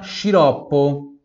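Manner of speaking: sciroppo said slowly, shi-ROP-po; slow Italian teacher model. Shown as a demonstration. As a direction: draw out sciroppo. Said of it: "Próxima palavra".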